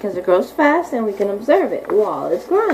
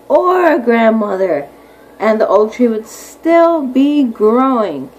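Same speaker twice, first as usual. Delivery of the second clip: unhurried, clear picture-book reading; slow read-aloud; 4 dB louder, slower than usual. Acted. Teacher reading about oak trees to her class, slow read-aloud. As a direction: draw the words out for little listeners.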